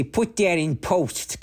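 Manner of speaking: high, knowing film-guy voice; movie director voice. Pretend. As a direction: play a pompous movie guy. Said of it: "I work in the movies and I say".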